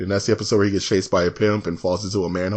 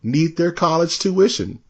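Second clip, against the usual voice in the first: nagging wife; 7 semitones higher than usual. Retold or imitated. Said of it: "She'd be like".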